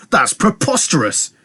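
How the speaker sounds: high, drawn-out outraged exclamation; spluttering exclamation. A narrator's reading. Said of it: "exclaimed Igor, expelling saliva as he spoke".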